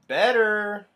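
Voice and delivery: loud, high call; parade leader. Acted. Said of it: "the leader of the parade says".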